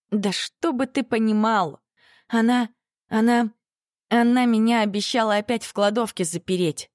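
high, shaken outburst, stammering; upset. A narrator's reading. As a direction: blurt out in distress. Girl upset about mother.

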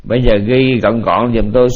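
ordinary talk

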